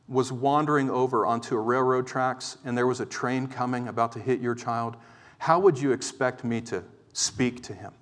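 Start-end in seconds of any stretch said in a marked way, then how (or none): none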